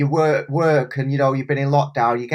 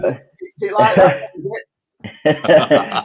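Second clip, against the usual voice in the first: mock offended; 3 dB louder, much slower than usual.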